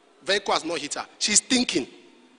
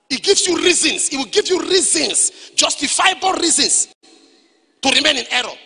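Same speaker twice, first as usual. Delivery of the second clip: louder, higher, insistent; emphatic preaching; 5 semitones higher, 6 dB louder than usual. Real, not acted. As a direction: drive the point home forcefully. Preacher hammering a point.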